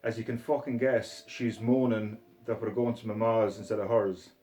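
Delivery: put-on Scottish accent. Reading Begbie's monologue to viewers in a Scottish accent. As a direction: read it as Begbie in broad Scottish. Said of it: "it sounds better with a Scottish accent".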